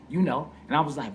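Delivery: normal talking voice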